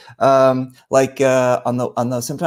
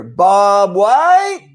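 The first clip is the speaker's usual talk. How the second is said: calling back; 5 semitones higher, 6 dB louder than usual. Retold or imitated. Distant campers answering the call.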